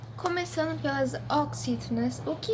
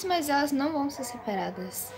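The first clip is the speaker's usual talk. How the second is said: ordinary talk, nothing marked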